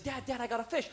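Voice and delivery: high, small kid voice, mock excitement; imitated child, high and eager; excited little boy. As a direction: pipe up like a boy calling his dad. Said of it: "he's really excited".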